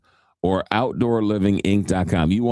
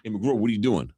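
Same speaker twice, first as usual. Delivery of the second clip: imitated New York accent; 3 dB quieter than usual. Shown as a demonstration. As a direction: greet him like a New Yorker. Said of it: "the New York accent".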